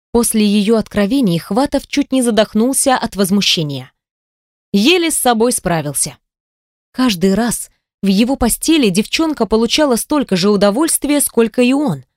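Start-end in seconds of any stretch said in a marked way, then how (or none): none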